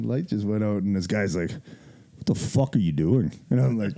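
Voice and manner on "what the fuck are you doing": slow, drawn-out mock tough-guy challenge; gym guy challenge. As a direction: drag it out like a big lifter calling you out. Gym guy confronts him.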